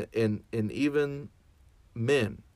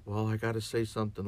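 hurried mock fluster; faster than usual. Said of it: "someone's just crying and".

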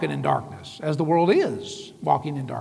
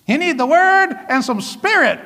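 much higher and louder, rousing a sleepy church; mock wake-up yell; 10 semitones higher, 5 dB louder than usual. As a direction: shout a dozing congregation awake. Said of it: "You're like".

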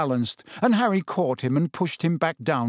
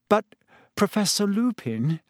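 shaky voice forcing calm; trembling would-be calm; 6 semitones higher than usual. A narrator's reading. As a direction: try to sound calm through fear. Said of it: "spoke in a trembling, would-be calm sort of voice".

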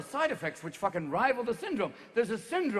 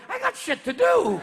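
high, drawn-out loud complaint; impatient penis character; 14 semitones higher, 5 dB louder than usual. Acted. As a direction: whine loudly to be let go. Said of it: "even my penis is going".

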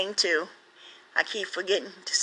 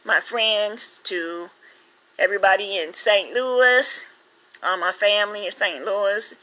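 ordinary talk, nothing marked

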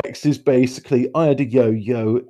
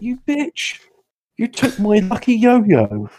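higher-pitched retold indignation; mock outrage; 7 semitones higher than usual. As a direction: act out an aggrieved accusation. Retelling his outrage at teacher.

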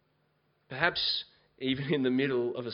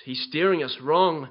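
louder, mild panicked complaint from the crew; panicked rowers; 8 dB louder than usual. A narrator's reading. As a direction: rowers shouting, half in earnest. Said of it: "moaned the rowers".

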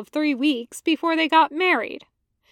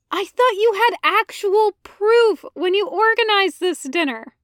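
higher, louder frustrated complaint; exasperated Ahsoka; 8 semitones higher, 5 dB louder than usual. Acted. Ahsoka to Anakin, higher exasperated complaint. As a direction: groan at his lack of proof. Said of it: "Ahsoka groans".

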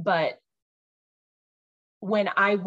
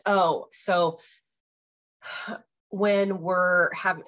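normal talking voice

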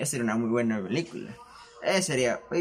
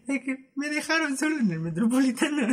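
high-pitched, laughing wail; comic wail; 13 semitones higher than usual. Retold or imitated. Teller recalling being left alone in the Metropolitano, high comic wail.

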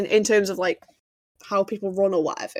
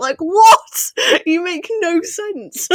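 incredulous; 8 semitones higher, 5 dB louder, much slower than usual. Real, not acted. Mocking the director's choice.

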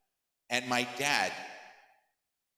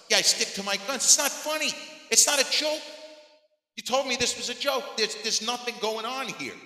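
put-on high-pitched, indignant kid protesting; frustrated child; 11 semitones higher, 3 dB louder than usual. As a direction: reenact a kid stubbornly insisting, voice pitched way up. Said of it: "I'm getting more and more frustrated".

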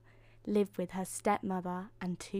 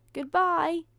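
high, louder friendly call; 9 semitones higher, 6 dB louder than usual. A narrator's reading. Cinderella to departing stepfamily, calling out. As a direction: call it after someone leaving. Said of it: "called Cinderella".

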